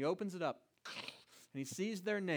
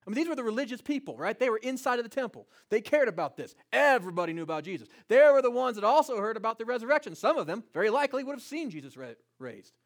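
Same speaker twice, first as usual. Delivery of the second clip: louder, fast, driving sermon delivery; full-force preaching; 4 semitones higher, 9 dB louder, faster than usual. Acted. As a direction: preach it hard to the congregation. Preacher building intensity at opening.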